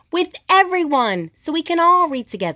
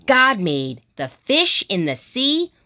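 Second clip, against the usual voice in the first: put-on reading to children; 2 semitones lower than usual. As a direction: read it gently to little kids, each line a small wonder. Reading picture books aloud.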